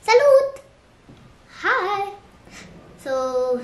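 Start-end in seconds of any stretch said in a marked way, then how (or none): 0.1-2.1 loud, very high hello; high bright greeting. Shown as a demonstration. Showing viewers how to say hi, chirpy. Models saying hi.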